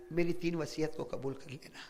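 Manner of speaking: dying wife softly asking her husband to accept her wishes; hushed last request. Acted. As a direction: let the words nearly die away. Fatima's last request to Ali.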